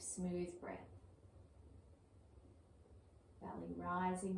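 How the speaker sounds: calm, even yoga breath cues with long pauses; soothing, unhurried meditation-teacher voice; guided breathing meditation. Acted. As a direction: guide the breath gently, leaving space between phrases.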